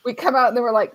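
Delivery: ordinary talk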